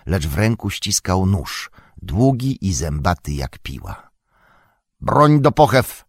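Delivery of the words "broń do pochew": loud, high barked command; shouting orc leader; orc chief's shout. A narrator's reading. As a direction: yell the order to sheathe weapons. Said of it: "krzyknął Ugluk".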